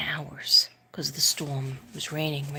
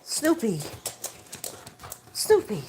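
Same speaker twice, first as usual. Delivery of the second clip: louder, higher call with a falling end; calling the dog; 6 semitones higher, 5 dB louder than usual. Real, not acted. Owner calls Snoopy to come out, loud and high. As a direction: call him in from across the room.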